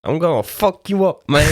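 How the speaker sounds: put-on high threatening Spanish accent; menacing bowler in Spanish accent. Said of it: "Doing Spanish accents".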